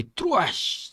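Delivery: high, sharp crashing effect; sudden mouth-made impact; imitated stab noise. A narrator's reading. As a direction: punch out a slashing noise. Imitates a blow in narration.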